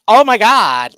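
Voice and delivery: mocking narrator voice. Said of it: "I'm like hearing".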